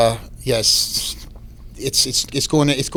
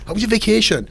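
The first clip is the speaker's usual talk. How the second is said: high, over-keen imitation of small talk; eager, raised mock politeness; dial turned up; 10 semitones higher than usual. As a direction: imitate starstruck staff fussing over a guest. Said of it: "the dial is turned up a little bit".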